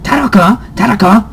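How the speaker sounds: put-on high, hammy Japanese accent calling a name; bad Japanese accent. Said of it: "the worst Japanese accent".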